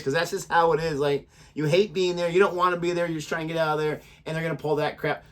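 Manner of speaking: ordinary delivery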